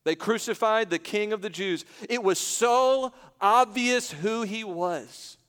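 emphatic pulpit declaration; impassioned preaching. Real, not acted. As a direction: drive it home, stretching the key words. Preaches at full force.

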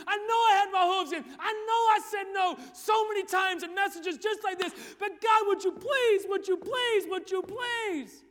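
desperate pleading. Acted. Sinner on the altar shouting a plea to God. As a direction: scream please again and again.

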